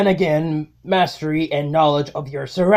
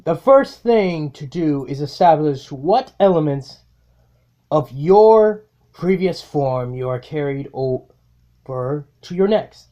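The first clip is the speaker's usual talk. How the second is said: measured, grave inner monologue; solemn self-narration. Acted. Villain narrating his own rebirth, grave and measured.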